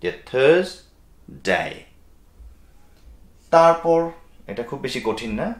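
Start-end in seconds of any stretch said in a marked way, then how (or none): 0.3-1.6 "থার্সডে" slowly, as thuhz... day; partial R. Shown as a demonstration. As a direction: pause before day in "থার্সডে", R only faint. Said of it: "forty percent". ("থার্সডে" ধীরে ভেঙে, র হালকা)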